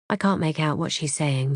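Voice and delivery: plain reading voice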